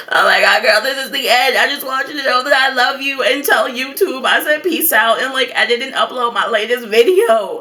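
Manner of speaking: high, hammed-up tearful goodbye; panicked farewell. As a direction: gasp out mock last words. Dramatic farewell text to friend.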